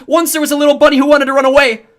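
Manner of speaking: loud, high, losing control; desperate yelling. A narrator's reading. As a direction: yell it, losing it. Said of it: "And I lost it. Over and over I yelled".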